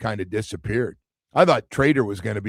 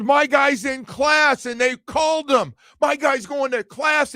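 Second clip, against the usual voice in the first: much higher, slow wrestling-promo shout of an angry coach; Dabo rant; 13 semitones higher, slower than usual. Retold or imitated. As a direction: ham up a fired-up coach yelling like a WWF promo. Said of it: "I just went a little W W F".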